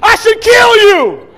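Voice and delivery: slow, high, loud comic rage; furious parent yell. Retold or imitated. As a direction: bellow it out like a frantic dad. Panicked parent scolding.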